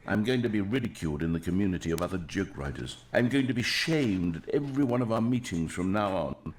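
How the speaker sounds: ordinary delivery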